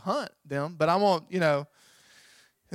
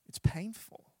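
whisper; 5 semitones higher, 4 dB quieter, slower than usual. Acted. Preacher aside after scripture.

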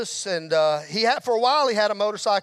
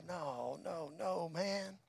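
very soft, choked-up imitation; hushed, as if holding back tears; retold tearful speech; 12 dB quieter than usual. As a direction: imitate a friend quietly tearing up. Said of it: "he's kinda sobbing and tearing up".

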